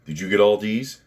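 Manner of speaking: brisk, mock-cheery admissions officer. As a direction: play a loud college recruiter asking about grades. Mock admissions officer asks student.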